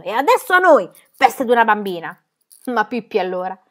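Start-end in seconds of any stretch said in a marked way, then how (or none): 0.0-2.2 policemen shouting. A narrator's reading. Policemen pouncing on the girl, shouting. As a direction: yell as you rush her.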